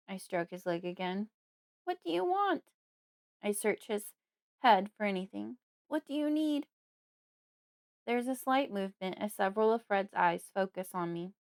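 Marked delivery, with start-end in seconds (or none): 1.9-2.6 very high, beseeching question; implore, on the edge of tears; tearful pleading. A narrator's reading. Daughter begging Fred to say his wish, tearfully. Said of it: "I'm starting to cry now". 5.9-6.7 high-pitched, imploring; tearful pleading. A narrator's reading. Daughter searching Fred for anything, pleading tearfully. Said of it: "I'm starting to cry now".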